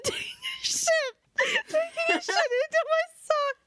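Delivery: high-pitched, crying with laughter; squealing through a laughing fit; helpless laughter. Real, not acted. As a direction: barely get the words out for laughing. Cracking up at own joke.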